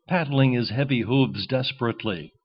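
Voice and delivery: ordinary delivery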